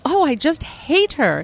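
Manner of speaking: high, slow, sugary-catty put-down; sweetly catty dismissal. Retold or imitated. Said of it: "everyone you've ever met that you go".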